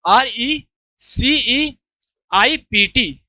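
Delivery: slow letter-by-letter आर ई सी ई आई पी टी; measured dictation, each letter separate; spelling aloud. Shown as a demonstration. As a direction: spell it out slowly for the class to repeat. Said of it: "मेरे साथ बोलो".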